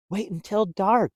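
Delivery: can hardly wait; excited. Acted. Stellaluna telling the birds to wait for dark, excited. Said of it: "Stellaluna said excitedly".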